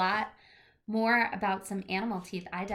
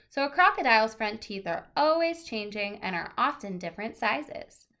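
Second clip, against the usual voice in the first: lively picture-book read-aloud, louder than her chat; reading to children; 2 semitones higher, 5 dB louder than usual. Acted. Teacher reading a teeth book to her class, lively read-aloud. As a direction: read it out brightly to a class of kids.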